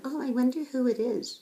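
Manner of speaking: high, sing-song fake wonder; mock innocent coworkers. Speaker mocking coworkers who fake curiosity about the unnamed employee, high sing-song voice. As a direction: play dumb like gossiping colleagues. Coworkers pretending not to know.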